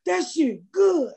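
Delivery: high, drawn-out praise; enthusiastic fan. Retold or imitated. Strangers walking up to praise the show, enthusiastic imitation. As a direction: imitate a stranger hollering a compliment. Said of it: "walking up to me saying".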